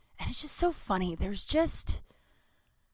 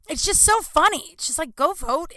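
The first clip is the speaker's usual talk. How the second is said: high, loud exasperated laugh line; exasperated outburst; 7 semitones higher, 5 dB louder than usual. Real, not acted. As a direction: burst out in disbelief.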